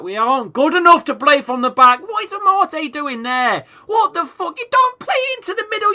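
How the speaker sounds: real shouting fury at the football; angry shouting. Real, not acted. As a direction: yell at the players through the screen. Ranting at his team's play.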